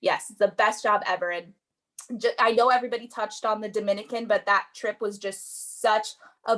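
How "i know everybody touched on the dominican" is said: ordinary talk, nothing marked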